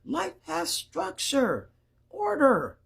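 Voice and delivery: high, slow, emphatic appeal; each point drawn out and falling from a high pitch; exasperated insistence. Real, not acted.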